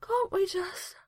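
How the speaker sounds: very high, yawning plea; mid-yawn. A narrator's reading. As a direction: let a yawn swallow the words. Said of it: "another yawn".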